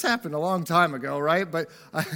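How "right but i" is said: normal talking voice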